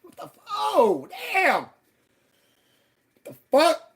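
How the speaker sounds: loud, very high outburst of horror; high yelp stretching into a drawn-out exclamation; shocked disgust. Retold or imitated. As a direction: reenact recoiling from a stink, yelling. Acts out disgusted discovery.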